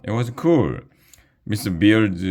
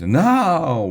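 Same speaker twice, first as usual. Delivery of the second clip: much higher, loud cry of no; shouted no; 11 semitones higher, 5 dB louder than usual. Acted. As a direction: cry out together in protest. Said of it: "we all shouted".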